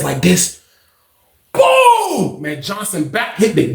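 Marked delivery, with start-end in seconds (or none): 1.5-2.4 high-pitched slam effect; imitated impact boom. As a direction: voice the body slam as a drawn-out boom.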